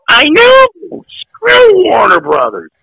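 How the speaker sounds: high, strained mock outburst; indignant retort. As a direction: fire back in a high, drawn-out whine of protest. Retold angry retort to announcer.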